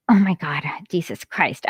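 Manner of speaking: whispered oh my God, under the breath; stunned, barely voiced; disbelieving whisper. Real, not acted. Streamer whispering in disbelief at the quote.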